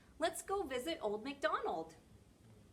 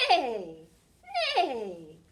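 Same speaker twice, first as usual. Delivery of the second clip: loud, falling whinny; whinny like a horse, tremulous and descending; horse neigh imitation; 14 dB louder than usual. Shown as a demonstration. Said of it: "A horse says".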